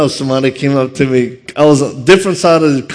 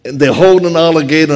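ordinary talk, nothing marked

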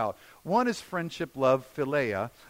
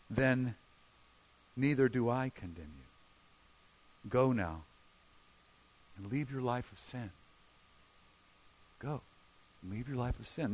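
low, soft, unhurried release; quiet, lowered, tender voice; gentle pardon; 5 semitones lower, 6 dB quieter than usual. Acted. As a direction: pardon her gently, pausing between phrases. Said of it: "he asks her gently".

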